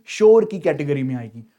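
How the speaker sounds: ordinary delivery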